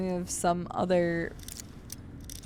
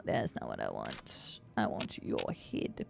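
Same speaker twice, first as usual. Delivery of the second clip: low creepy voice; 9 dB quieter than usual. Acted. Game enemy taunt.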